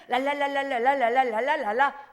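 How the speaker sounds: 랄랄라 and 렐렐레 flicked fast with the tongue alone; just flap the tongue, lips still; tongue-only babble. Shown as a demonstration. Showing what tongues sound like when only the tongue moves. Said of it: "맨 처음에는".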